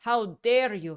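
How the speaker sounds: loud angry retold blast; furious outburst. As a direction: blow up at the intruder. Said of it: "He just blew up".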